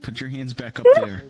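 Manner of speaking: soft, hushed scene-setting; low-key suspenseful narration; game master narration. A narrator's reading. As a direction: draw the players in quietly.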